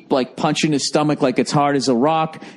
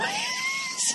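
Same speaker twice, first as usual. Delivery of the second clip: squealing laugh; 12 dB quieter than usual. Real, not acted. Laughing at his own story.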